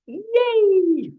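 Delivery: happy cheering yay. Real, not acted. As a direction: cheer the group on. Cheering after the laugh exercise.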